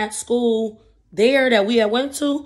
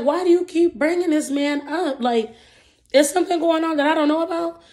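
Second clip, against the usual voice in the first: raised, pointed questioning; annoyed; 7 semitones higher than usual. Retold or imitated. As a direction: call her out, irritated. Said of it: "And I'm just like".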